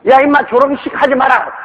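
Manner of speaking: high-pitched scolding call; shouting at the echo. Acted. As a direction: call out indignantly across a valley.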